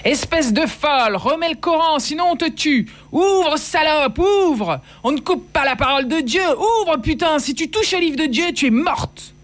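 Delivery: high-pitched furious yelling at a locked door; men yelling threats. Acted. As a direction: hurl the threats as an enraged mob. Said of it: "J'ai entendu les hommes hurler".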